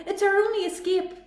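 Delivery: panicked robot shouting to escape bees; urgent shout. A narrator's reading. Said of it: "Rusty shouted".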